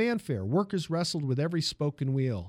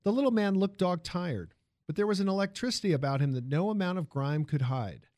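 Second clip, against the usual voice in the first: ordinary delivery, nothing marked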